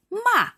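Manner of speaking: "Ma" falling sharply from high; fourth tone. Shown as a demonstration. Teacher showing the fourth tone, "Ma" sharply down. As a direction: start "Ma" high and drop it fast. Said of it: "fourth tone is sharply down".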